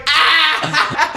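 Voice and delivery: high laughing yelp; laughing scream. Retold or imitated. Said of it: "they were just like".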